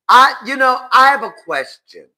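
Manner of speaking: high, loud mock-serious start; loud emphatic question. Real, not acted. Vlogger raising a question to viewers, loud and emphatic. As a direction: demand attention, high and loud. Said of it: "This is a serious question".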